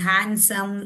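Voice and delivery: handsome with no d, han-sum; silent d. Shown as a demonstration. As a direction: say handsome leaving out the d. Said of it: "D is silent". (handsome में d silent, हैनसम)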